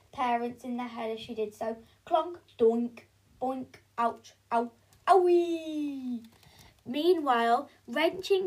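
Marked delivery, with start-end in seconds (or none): 2.1-6.3 1 comic bonks and ouches. Acted. Sound effects of pole hits.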